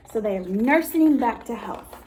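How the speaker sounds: usual speaking voice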